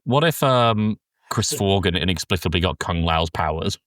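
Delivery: usual speaking voice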